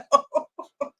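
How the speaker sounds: very high giggling squeal; real squealing giggle over a favourite character; squealing laugh. Real, not acted. Laughing about missing Francis.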